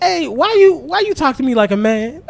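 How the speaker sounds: high-pitched imitation of a puzzled small child; little son. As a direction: mimic a little boy questioning his dad. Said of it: "he gonna like".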